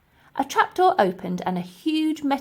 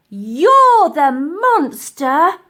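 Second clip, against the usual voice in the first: put-on high, loud, slow furious girl; seething; 8 semitones higher, 12 dB louder, much slower than usual. Elsie turning on the professor, furious loud cry. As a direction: spit it at him, slow and loud. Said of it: "seethed Elsie".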